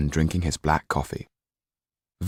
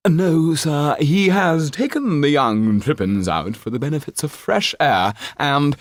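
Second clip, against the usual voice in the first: much higher and louder, grand stage manner; theatrical old actor; 9 semitones higher, 5 dB louder than usual. Acted. Actor Trippin telling the banker about the boy, theatrical old actor. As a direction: declaim like a hammy actor.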